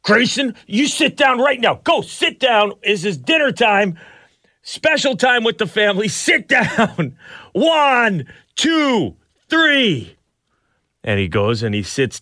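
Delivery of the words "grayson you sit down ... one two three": higher, mock-stern scolding with a laugh breaking through; frustrated parent. Dad scolding his toddler son at dinner, mock-stern and exasperated. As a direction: play the exasperated dad counting down. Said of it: "I'm getting frustrated at this point".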